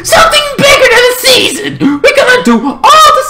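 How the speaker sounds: high, fast shriek; hyped escalation. Pretend. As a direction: squeal it as the bigger reveal. Escalates the announcement.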